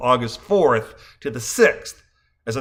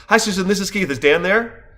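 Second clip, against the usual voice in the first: rapid, higher-pitched phone greeting; quickly spoken greeting; 6 semitones higher than usual. Retold or imitated. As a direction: rattle off a quick hello on the phone. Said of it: "my quite quickly spoken query".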